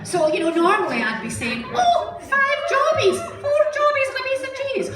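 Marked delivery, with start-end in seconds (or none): 1.7-4.9 very high, loud comic cry; hammed-up high exclamation. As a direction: belt out the punchline at high pitch. Replays the punchline in Scottish wording.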